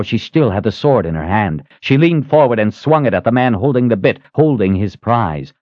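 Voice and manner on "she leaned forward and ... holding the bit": usual speaking voice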